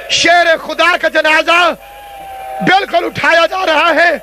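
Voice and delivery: impassioned crier's lament at full pitch; funeral proclamation. Real, not acted. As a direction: call the mourners phrase by phrase, with long pauses.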